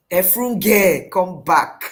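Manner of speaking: loud call after someone leaving; acted summons at full voice. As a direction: call her back firmly and loudly. Angel calling Hagar back.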